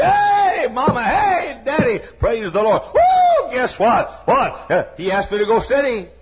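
high, loud joyful hollering; shrieking with joy at the news; excited shouting. Retold or imitated. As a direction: holler it out like a thrilled daughter. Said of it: "came home hollering and screaming".

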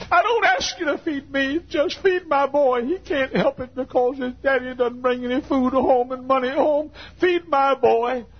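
high, pleading prayer with a crying voice; imitating his weeping mother praying for food; mother's tearful prayer. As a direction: beg God through tears, voicing a poor mother. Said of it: "and she began to cry".

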